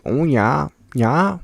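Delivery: mock voice. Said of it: "It's like".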